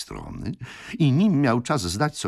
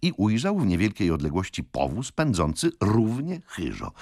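ordinary delivery

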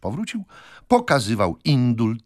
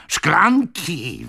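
high, louder, drawn-out exclamation; impetuous yell; 9 semitones higher, 5 dB louder than usual. A narrator's reading. Old man scoffs at a glass of water, yelling. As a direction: yell it in scornful outrage. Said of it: "wrzasnął impetyczny starzec".

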